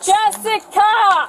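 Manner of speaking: drawn-out scream of a name. Real, not acted. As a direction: yell her name across the street. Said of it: "Stop screaming".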